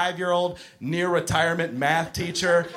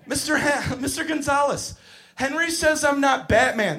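put-on high-pitched little kid complaint; tattling child; 9 semitones higher than usual. Child telling Mister Gonzalez about Henry, high whine. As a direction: whine it up to the teacher.

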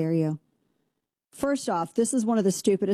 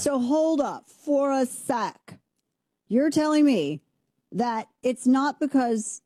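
slow, higher put-on imitation of her; mock interviewer voice; 6 semitones higher, slower than usual. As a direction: lightly parody the questioning. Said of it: "Well, I was imitating".

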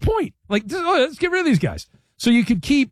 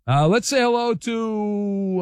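ordinary talk

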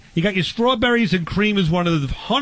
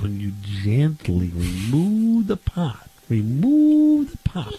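slow, then swoop way up on remove the pop; milk it like a big reveal; hammed-up slow finish; 3 semitones lower, much slower than usual.

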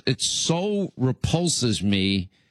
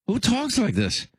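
much higher, appalled disbelief; incredulous repeat; 11 semitones higher than usual. Real, not acted. Host to listeners after the tape, appalled and incredulous. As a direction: ask again as if you can't believe it.

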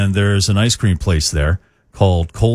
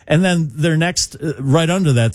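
ordinary delivery

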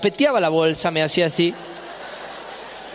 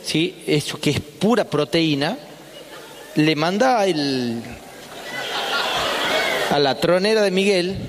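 animated healer. Retold or imitated. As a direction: sell the remedy like an expert. Healer explains the cure.

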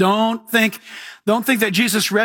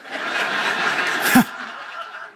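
soft breathy chuckle; quiet airy laugh; 3 dB quieter than usual. Real, not acted. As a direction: laugh at the memory. Laughs at own story.

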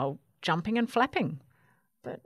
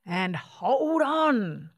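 excited warning; 3 dB louder, slower than usual. A narrator's reading. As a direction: call out as the ride takes off. Cooter warns before takeoff.

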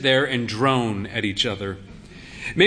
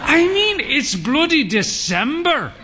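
indignant; 13 semitones higher than usual. Acted. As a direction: splutter in comic indignation. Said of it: "he said".

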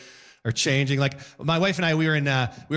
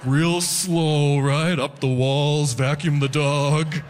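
slow, louder, dazed stoner drawl; stoned guy; 3 dB louder, much slower than usual. Retold or imitated. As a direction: zone out on the chore. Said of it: "just be like".

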